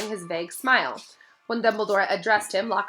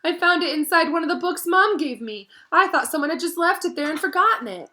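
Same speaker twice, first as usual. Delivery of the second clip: tearful; 9 semitones higher, 6 dB louder than usual. A narrator's reading. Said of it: "sobbed Ginny".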